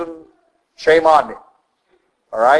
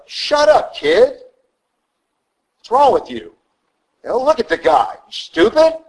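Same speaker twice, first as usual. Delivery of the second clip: higher, mocking brush-off; scolding soldier; 10 semitones higher than usual. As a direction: scoff at the kid, pitched up.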